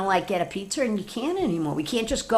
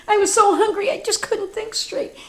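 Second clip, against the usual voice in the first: sheepish wolf; 13 semitones higher, 4 dB louder than usual. Acted. Embarrassed wolf apologising.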